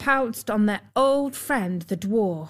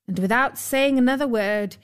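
plain reading voice

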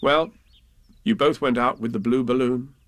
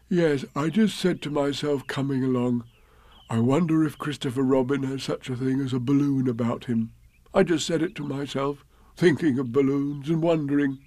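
lightly voiced bear, hopeful; 2 semitones higher than usual. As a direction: ask as a bear hinting politely.

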